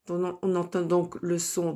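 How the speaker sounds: normal talking voice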